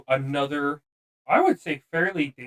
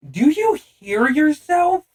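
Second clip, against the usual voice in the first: incredulous; 13 semitones higher, 7 dB louder, slower than usual. Retold or imitated. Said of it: "And Mark's like".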